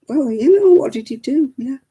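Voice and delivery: gentle coaxing. Retold or imitated. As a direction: coax the little one gently. Gently questioning a crying grandson.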